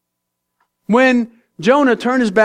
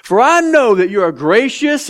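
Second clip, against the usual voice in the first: sarcastic Jonah; 6 semitones higher than usual. Acted. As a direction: pray as if God's grace is a complaint. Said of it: "you can hear the sarcasm".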